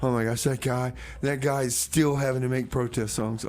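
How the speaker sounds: ordinary talk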